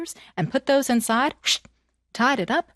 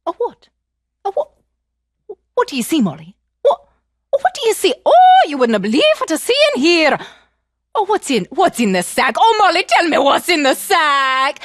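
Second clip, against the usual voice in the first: higher, pleading, ever more eager; curious wife; 7 semitones higher, 6 dB louder than usual. Acted. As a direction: beg to know what's inside. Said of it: "started to get curious".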